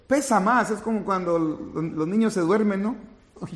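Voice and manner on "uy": ordinary talk, nothing marked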